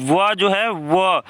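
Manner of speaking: व drawn out very slowly, lips pressed for the sound. Shown as a demonstration. Teacher to students showing where व is spoken, slow demo. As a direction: stretch each व slowly to show it comes from the lips. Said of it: "तो वोष्ठ होना चाहिए".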